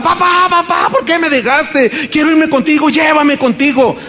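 high wailing cry for her dead father; grieving daughter crying out at the burial; anguished cry. Retold or imitated. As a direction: mourner calling out to papá, voice cracking. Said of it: "volvía en sí y gritaba".

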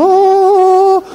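mock sob gasp. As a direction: ham up a tearful gasp. Boyfriend fakes emotion at film.

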